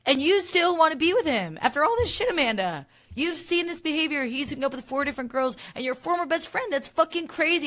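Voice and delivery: high, loud mock outrage; scandalized, high-pitched scolding; outraged retelling. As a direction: imitate someone aghast, pitch shooting up. Retelling Carl's outraged challenge to Amanda.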